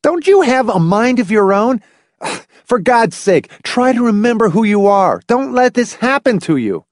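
high, desperate, exasperated outburst; strained high-pitched yelling; roaring. A narrator's reading. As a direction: plead furiously with a bewildered old man. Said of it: "Hamilton roared at the bewildered old man".

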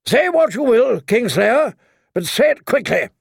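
high-pitched, imperious reply; enemy knight calling. A narrator's reading. Old knight answering Kingslayer from his galley, imperious call. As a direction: answer across the water, voice lifted high.

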